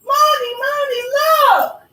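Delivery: put-on high, loud child's voice; an eager kid calling his mom; excited little boy. Boy who found the mound calls to his mother excitedly.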